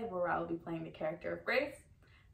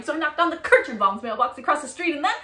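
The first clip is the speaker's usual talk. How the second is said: loud, higher, breaking off mid-thought; manic escalation; 7 semitones higher, 14 dB louder than usual. Acted. Woman recounts attacking the neighbour's mailbox, carried away. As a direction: get carried away with the rampage, then stop short. Acted gleeful escalation of story.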